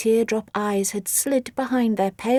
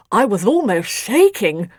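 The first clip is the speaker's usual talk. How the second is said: lying creature voice; 5 dB louder than usual. Acted. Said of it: "lied Writhe".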